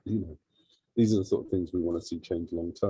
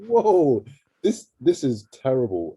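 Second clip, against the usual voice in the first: louder, higher "whoa" of disbelief; retold shock; 5 semitones higher, 7 dB louder, slower than usual. Charity head recalls losing funding, shocked. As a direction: act out being stunned by bad news.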